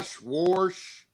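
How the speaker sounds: "wash" said with an added R, like "worsh"; R-coloured vowel. Retold or imitated. Friend mocking how Kentuckians add O R, R-coloured vowel. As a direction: put a thick R into "wash". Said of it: "sounds like they add O R to it".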